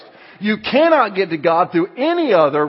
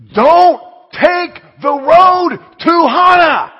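loud, high, slow shouted warning; drawn-out shouted warning on voicemail; booming warning; 13 semitones higher, 11 dB louder, much slower than usual. Retold or imitated. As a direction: bellow it like an urgent warning. Pastor friend's voicemail warning.

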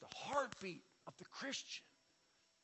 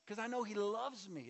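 usual speaking voice

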